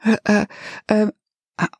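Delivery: halting, broken hesitation noises; stammer, unable to get a word out; flustered false starts; stuttering. A narrator's reading. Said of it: "Angel stuttered".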